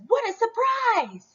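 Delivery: high, excited little chick voice; a high-pitched child's surprised cry; child chicken. A narrator's reading. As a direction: squeal it like a tiny chick.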